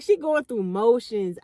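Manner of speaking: ordinary talk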